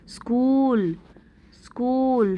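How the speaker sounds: स्कूल with the oo drawn out; स्कूल as a model word, oo stretched; long oo. Shown as a demonstration. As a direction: hold the vowel in स्कूल before the l. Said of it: "long है".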